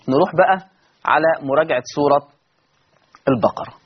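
usual speaking voice

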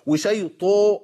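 exaggerated imala on وشي طو; wrong vowel tilt. Shown as a demonstration. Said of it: "عملت اماله للحركه برضه".

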